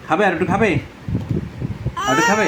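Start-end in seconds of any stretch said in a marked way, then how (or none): 2.0-2.5 put-on squeaky baby talk. High playful coaxing of baby.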